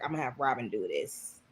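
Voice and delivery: low, hushed quote; quietly voiced aside; imitated aside. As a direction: drop it right down like a private plan. Quoting her scheming aside.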